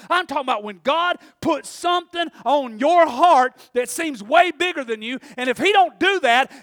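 loud, high revival preaching; full-force preaching. Real, not acted. Preacher urging believers to trust God, shouted sermon. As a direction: drive each phrase home at a shout.

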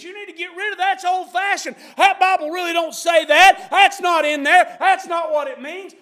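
high, loud, pushy nagging to give up the Bible; pushy worldly voice. Acted. The world pushing a believer to drop the Bible, high and loud. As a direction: badger him to chuck his beliefs.

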